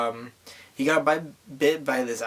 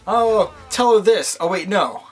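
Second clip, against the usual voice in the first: a hammed-up shout, much higher and louder; mock calling out; 7 semitones higher, 8 dB louder than usual. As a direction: yell it down the platform, then catch yourself. Hero calling down the platform.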